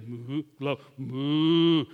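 low, soft mooing call; cow moo. Retold or imitated. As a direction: moo like a cow to catch its eye. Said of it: "and you can say".

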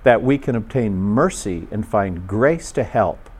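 slow, measured scripture reading with long pauses. Real, not acted. Preacher reading scripture to listeners with closed eyes, very slowly. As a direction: read it slowly, a phrase at a time. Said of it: "I'll read it very, very slowly".

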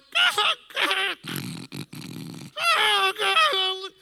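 loud, high choking wails; laughter disguised as sobbing. Retold or imitated. Leaning over dying father, covered-up laughter. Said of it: "gotta cover this up better".

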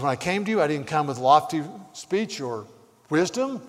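emphatic pulpit reading; forceful scripture reading. Real, not acted. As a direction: proclaim the apostle's words to a congregation. Reads scripture at preaching force.

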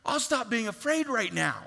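mock someone suddenly cured of fear; hammed-up fake okay; sarcastic mock relief. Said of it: "No one is".

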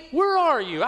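high, quick anguished plea; pleading cry. Retold or imitated. Preacher voicing a believer who feels abandoned, urgent high cry. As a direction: throw the question up to heaven. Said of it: "we just cry out".

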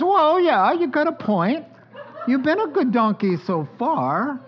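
high, sheepish, laughing concession; Balaam answering. Acted. Balaam admitting the donkey's right, high and laughing. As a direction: give in with a high, chuckling "fair point". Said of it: "He says".

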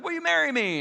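high, sudden, overwhelmed blurt of a proposal; mock blurted proposal. Guy overwhelmed with emotion blurts to girlfriend, mock excited high blurt. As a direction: pop the question on a rush of emotion.

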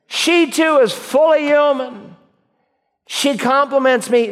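high-pitched, elated exclamations; Adam. Acted. Adam first seeing the woman, exclaiming in high excitement. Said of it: "Adam exclaimed".

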